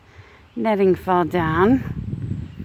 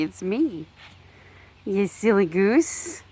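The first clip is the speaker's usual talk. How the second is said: normal talking voice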